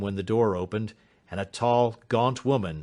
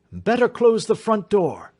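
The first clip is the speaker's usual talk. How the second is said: a high, loud urgent call; urgent cry; 11 semitones higher, 5 dB louder than usual. A narrator's reading. Detective warns the others as the dog comes, calling loudly. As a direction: snap out the warning fast. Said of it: "cried Holmes".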